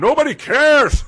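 high, loud and slow exasperated yell; mock shout. Son fed up with his mom's chatter, exasperated mock shout. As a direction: blow up at her in frustration. Son snaps at mom in story.

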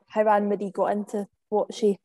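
ordinary talk

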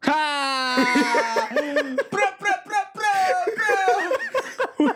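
high laughing bro bro. Real, not acted. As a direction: crack up while saying bro. Laughing at a joke.

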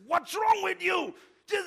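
high, loud mock shout at his son; angry outburst. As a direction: snap it out like a furious dad. Retelling blowing up at son.

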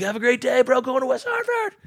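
high, sing-song mock goodbye; cheery retold farewell. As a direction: toss off a cheery goodbye to a friend. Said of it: "We're like".

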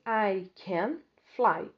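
"fly" drawn long and falling; slow model sentence. Shown as a demonstration. Teacher dictating the answer to students, slow. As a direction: dictate it slowly. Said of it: "Você tem que escrever assim".

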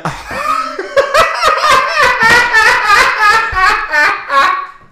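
very high, loud burst of laughter; shrieking laugh. Real, not acted.

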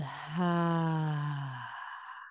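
long, audible breathy "hah" sigh; slow sigh out. Shown as a demonstration. Coach models breathing out for listeners, long slow sigh. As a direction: let the breath pour out in one long sigh. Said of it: "slowly sigh it out".

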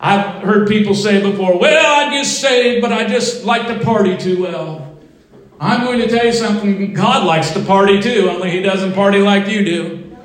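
full-force preaching. Real, not acted. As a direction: drive it home to the congregation.

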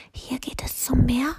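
hushed, voiceless question; whispering giant asks the way; giant's whisper. A narrator's reading. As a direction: whisper it hopefully. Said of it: "flüsterte der Riese".

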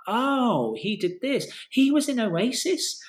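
amazed realisation, replayed; retold surprise. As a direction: act out piecing it together, pitch jumping up. Retold reaction to a discovery.

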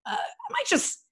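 high, hesitant pretend-casual voice; feigned casualness. Office worker inventing an excuse to pass a crush, feigned casualness. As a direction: float it up as if it's no big deal.

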